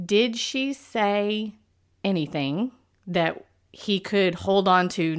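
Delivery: ordinary talk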